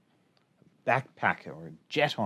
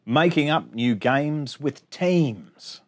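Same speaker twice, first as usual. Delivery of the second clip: bouncy sing-song rhyme reading; reading to children; 4 semitones higher, 7 dB louder, much slower than usual. Acted. Teacher reading a rhyming picture book to her class, sing-song. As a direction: read the rhymes out brightly for little kids.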